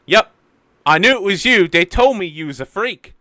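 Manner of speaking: put-on high, loud cheeky child voice; cheeky girl. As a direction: needle him like a bratty kid. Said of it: "And she's like".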